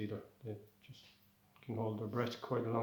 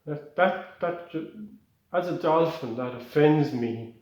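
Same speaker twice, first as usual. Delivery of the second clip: outraged; 3 semitones higher, 8 dB louder, slower than usual. Acted. Dolphin outraged.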